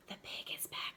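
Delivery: secretary's hushed whisper; a secretary whispering the news to the principal. A narrator's reading. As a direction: breathe it out secretly, no voice. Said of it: "she whispered".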